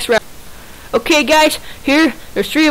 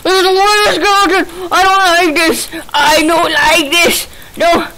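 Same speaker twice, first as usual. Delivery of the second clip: panicked whine; 7 semitones higher, 3 dB louder than usual. Real, not acted. Panicked under attack.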